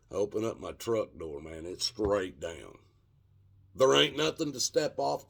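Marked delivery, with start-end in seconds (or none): none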